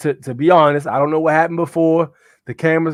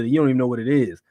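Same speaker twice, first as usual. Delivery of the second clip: usual speaking voice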